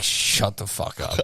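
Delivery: slow, whispered retort; drawn-out whisper; retold hushed warning. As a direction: whisper it slowly as a put-down. Retells telling off a rude stranger.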